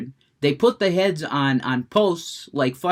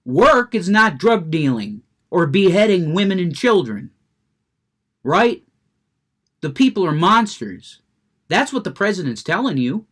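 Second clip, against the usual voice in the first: louder, real heated rant; worked up; 3 semitones higher, 6 dB louder than usual. Real, not acted. Streamer telling off the snowflakes, worked up. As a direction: press the point with rising heat. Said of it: "I'm getting worked up".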